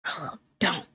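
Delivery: very soft hiss through teeth; whispered through clenched teeth. Acted. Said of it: "whispered through clenched teeth".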